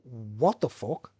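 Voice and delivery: slow, stretched-out bewilderment; imitated stunned reaction, very slow; retold disbelief. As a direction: draw it out, recalling being taken aback. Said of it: "I was a bit like".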